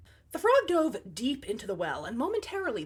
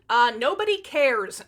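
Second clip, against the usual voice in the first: loud, slow, dismissive brush-off; 8 dB louder than usual. Pretend. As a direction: wave them off loudly. Mock dismissal of middle children.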